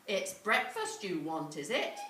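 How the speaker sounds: a hint of a gruff big woman; loud, teasing warning to the boy; giant's wife. A narrator's reading. Giant's wife teasing hungry Jack, gruff and loud.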